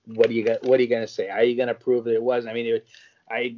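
normal talking voice

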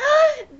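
very high sharp gasp of joy; delighted gasp. Retold or imitated. Seeing the goat man on screen, very high delighted gasp. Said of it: "I was just like".

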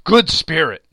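begging from the ground; imploring. A narrator's reading. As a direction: appeal urgently. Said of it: "he pursued, as down upon the ground he fell".

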